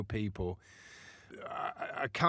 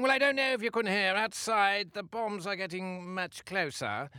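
higher-pitched imitation of a very posh English accent; 9 semitones higher than usual. Playwright mimics a posh English war reporter in Kabul, hammed-up posh English accent. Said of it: "speak like this".

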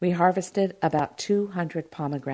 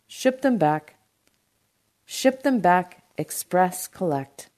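plain reading voice

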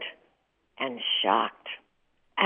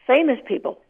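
normal talking voice

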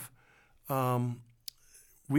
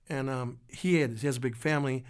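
usual speaking voice

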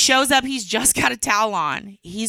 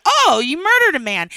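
mock shock; 6 semitones higher, 7 dB louder than usual.

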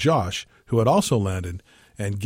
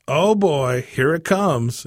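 exclaiming; 5 semitones higher, 4 dB louder, much slower than usual. A narrator's reading. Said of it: "exclaimed Josh".

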